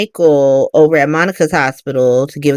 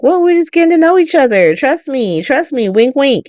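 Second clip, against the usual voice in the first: sly excuse; 8 semitones higher than usual. Acted. Michael's sly excuse, acted quote.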